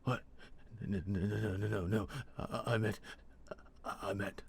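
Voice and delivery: stammered panicky backtracking; sudden terror. A narrator's reading. Captive realizing what he let slip, terrified stammer. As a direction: stumble over the words, trying to take them back. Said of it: "overcome with sudden terror".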